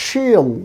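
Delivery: she'll as a high, falling "sheeel"; elongated vowel. Shown as a demonstration. Teacher asks learners to listen, long vowel. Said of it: "কিভাবে উচ্চারণ করতে হবে আবার শোনেন".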